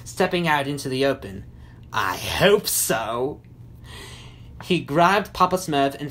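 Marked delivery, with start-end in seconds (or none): 1.9-3.4 low, very slow sinister voice; low drawn-out villain gloat. A narrator's reading. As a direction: relish it menacingly, drawing it out. Gargamel gloats, stepping out.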